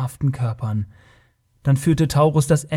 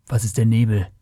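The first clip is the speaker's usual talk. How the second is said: slightly lowered, unsure question; uncertain; 3 semitones lower than usual. A narrator's reading. Conan asks Taurus about the fog, mildly unsure. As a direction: ask it with a touch of uncertainty. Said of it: "fragte der Cimmerier unsicher".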